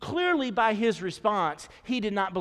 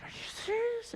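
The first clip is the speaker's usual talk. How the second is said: disbelieving; 7 dB quieter than usual. Retold or imitated. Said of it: "You're like".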